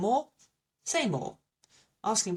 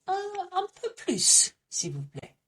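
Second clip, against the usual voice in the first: much higher, keen request for more cheese; asking sweetly for a bigger slice; eager cheese lover; 12 semitones higher than usual. Acted. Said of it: "so I would be saying".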